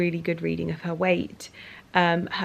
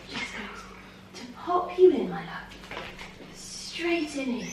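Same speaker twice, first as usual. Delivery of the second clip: hushed, high soothing murmur; soft coaxing; 3 semitones higher, 11 dB quieter than usual. Real, not acted. Owner gently easing her cat into the carrier, hushed.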